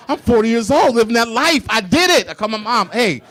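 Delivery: loud, high gleeful brag; laughing triumphant boast. Real, not acted. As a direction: crow it with laughing pride. Triumphant punchline.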